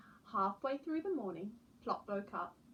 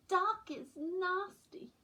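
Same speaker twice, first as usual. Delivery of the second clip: slow whiny owlet; 10 semitones higher, 4 dB louder, much slower than usual. Acted. Owlet Plop dreads the dark.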